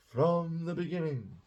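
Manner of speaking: lifted, theatrical quote of a film line. Retold or imitated. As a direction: declaim it like a line from a period film. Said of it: "Sorry, that was a, from a film".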